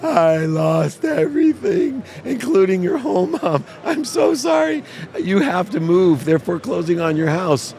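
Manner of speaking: high, drawn-out mock wail; blurting like a four-year-old. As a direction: wail the confession like a sobbing small child. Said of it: "blurted it out like a four-year-old".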